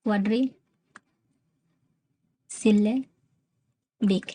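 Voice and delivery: "क्वाड्रीसिलेबिक" in slow chunks with long pauses; "quadri", pause, "सिले", pause, "बिक"; slow, broken up. Shown as a demonstration. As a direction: dictate "क्वाड्रीसिलेबिक" piece by piece. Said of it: "आप उसको क्वाड्रीसिलेबिक".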